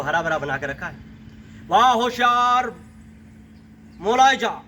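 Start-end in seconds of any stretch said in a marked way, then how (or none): 1.7-4.6 high, sustained proclamation; ringing herald's chant on one raised note; court herald. Acted. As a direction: announce a royal entrance, stretching the calls. Herald announces Sultana's royal entrance.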